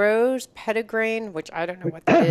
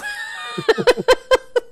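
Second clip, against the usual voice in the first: bursting, high-pitched laugh; loud high laugh; 4 dB louder than usual. Real, not acted. Laughing after the show preview.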